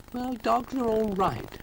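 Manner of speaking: very high, slow character voice; high slow grudging voice. Acted. Mister Rusty voiced by narrator.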